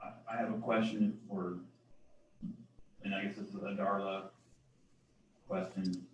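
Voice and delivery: mumbled under the breath; barely audible. Real, not acted. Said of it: "I can't hear him at all".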